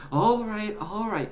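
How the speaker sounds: high-voiced boy giving in; conceding. A narrator's reading. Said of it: "conceded Alfie".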